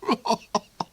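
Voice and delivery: a high, far-off villain's cackle; high cackling laugh. Acted. The Duke cackles at his own dark joke. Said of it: "He laughed".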